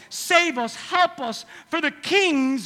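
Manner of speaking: high, loud plea; pleading. Acted. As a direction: cry out for help. Pleading quote repeated.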